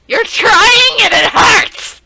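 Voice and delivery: high, loud, slow anguished yell; pained yell. Real, not acted. Streamer yelling at her failing turtles, loud and slow. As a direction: cry out at the screen in mock agony.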